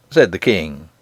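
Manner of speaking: ordinary delivery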